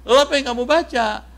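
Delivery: high, fast and eager asking; eager question. Acted. Friends eagerly asking Abu Musa what he read, high and quick. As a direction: press him for the answer, curious and quick.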